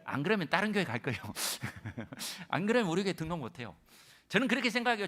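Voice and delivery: ordinary talk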